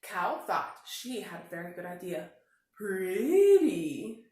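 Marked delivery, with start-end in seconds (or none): 2.7-4.3 cow admiring. A narrator's reading. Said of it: "she said".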